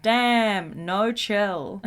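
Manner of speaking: ordinary talk, nothing marked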